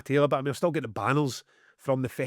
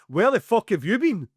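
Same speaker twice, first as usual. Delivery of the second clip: sharp, louder, higher angry demand; angry retold yell; 7 semitones higher, 5 dB louder than usual. Shouting at the hotel manager who finally shows up, angry. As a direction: snap the question at the late manager. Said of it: "by this point I'm angry".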